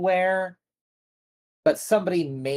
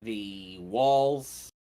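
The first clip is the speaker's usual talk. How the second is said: normal talking voice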